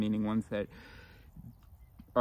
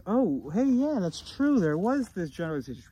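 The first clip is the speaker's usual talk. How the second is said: higher-pitched, louder voice of sudden realization; higher inner voice; 6 semitones higher, 4 dB louder than usual. Acted. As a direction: play an inner voice that just noticed something and takes charge. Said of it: "So now that the higher voice has".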